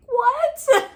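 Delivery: sudden high squeak of surprise; startled what. Real, not acted. Stumbling mid-sentence to viewers, a sudden high surprised what. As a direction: catch yourself off guard, squeaking. Cuts himself off mid-word.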